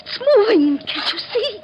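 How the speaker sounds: very high, half laughing in terror; hysterical fright. Acted. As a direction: shriek it, losing control. Skull moving by itself.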